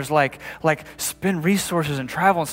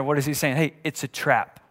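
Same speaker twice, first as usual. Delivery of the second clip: ordinary talk, nothing marked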